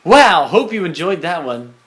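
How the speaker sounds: loud, upbeat TV host voice; late night promo host. Pretend. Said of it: "act like I just listened".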